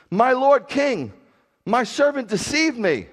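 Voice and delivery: high, loud protest to the king; impassioned plea. Acted. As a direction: cry out the accusation, drawing out the address. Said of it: "I say it like that because".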